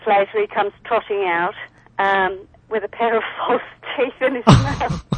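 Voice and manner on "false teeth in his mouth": breaking into real laughter mid-phrase; tickled, laughing through the words; laughing while telling. Real, not acted. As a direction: crack up as you reach the punchline. Cracks up at own dog story.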